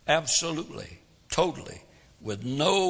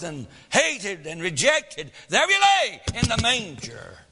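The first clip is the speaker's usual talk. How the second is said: rising, louder preacher's cadence; preaching at full force; 3 dB louder than usual. Acted. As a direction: build the sermon up with fire.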